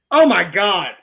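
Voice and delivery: ordinary delivery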